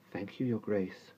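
put-on soft, slow girl's reply; quiet polite girl; shy girl. A narrator's reading. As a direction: answer the Duchess shyly. Sophie answers the Duchess.

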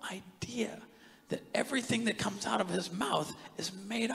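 slow, hushed stunned thought; scared realization. Retold or imitated. Preacher relives a silent thought about a lying foreman, scared and hushed. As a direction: let it dawn on you slowly, under your breath. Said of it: "It scared me".

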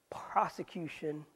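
breathy whispered final word of the list, slow. Real, not acted. Preacher listing Paul's trials, ending in a whisper. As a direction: let the last word fall to a slow whisper.

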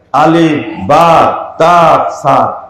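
আলিফ, বা, তা, সা spelled out very slowly; drawn out like teaching a child the alphabet; slow letter-by-letter. Shown as a demonstration. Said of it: "পড়েন". (ধীরে ধীরে বর্ণ উচ্চারণ)

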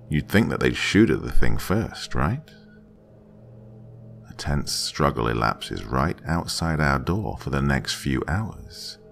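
usual speaking voice

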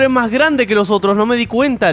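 usual speaking voice

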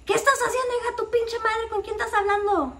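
high-pitched mock mom in Spanish; imitating a nosy mom catching her on the phone; mom scolding. Mom catching her daughter on the phone, high mock scolding. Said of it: "my mom gets the other phone and she's like".

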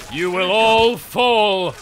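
slow, loud warlord proclamation; villain boast. Pretend. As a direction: declare it like a conquering tyrant. Villain boast to game enemies.

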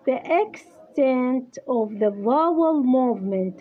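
English phrase read very slowly; slow dictation. Shown as a demonstration. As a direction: spell it out slowly for the student to write down. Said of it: "تكتبي لي هذا". (إملاء بطيء)

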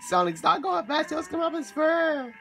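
put-on high, squeaky anime girl voice. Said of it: "that's my anime voice".

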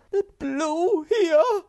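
very high, slow, loud with a laugh; squeaky disbelieving laugh; astonished. Acted. Hemulan astonished.

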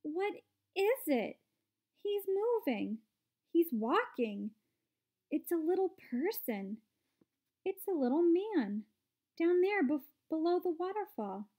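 high-pitched, excited exclamations; mild storybook version of a screaming girl. A narrator's reading. Veruca spots a tiny man across the river, excited screaming. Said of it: "screams of excitement".

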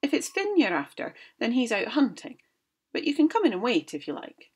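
high, loud, stern mother scolding. Acted. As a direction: tell the visiting giant off sharply. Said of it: "said in a stern voice".

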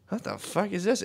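high, soft, mock-appalled aside; hushed disbelief. Host retelling reading a disturbing story, hushed high disbelief.

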